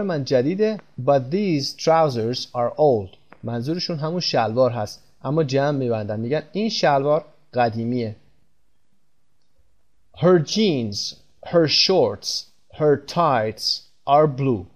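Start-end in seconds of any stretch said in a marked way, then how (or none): 1.0-3.2 My suit is new, very slow and clear; slow example sentence. Shown as a demonstration. Teacher reading an example sentence to students, very slow. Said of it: "بریم پایین مثال". 10.2-14.7 each item spaced out slowly; slow example sentence. Shown as a demonstration. Teacher reading an example to students, slow and clear. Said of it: "بریم پایین مثال".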